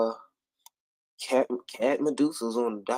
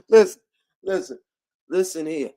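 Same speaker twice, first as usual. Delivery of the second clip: ordinary delivery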